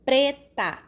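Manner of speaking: ordinary delivery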